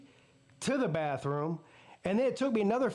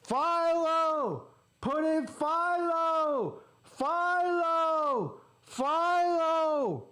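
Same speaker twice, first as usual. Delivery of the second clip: high, drawn-out heckler's yell; drunk fan's yell; 9 semitones higher, much slower than usual. Retold or imitated. Drunk fan behind him heckling the team, yelling high and long. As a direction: holler it like an obnoxious fan. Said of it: "He yelled that for fifteen minutes straight".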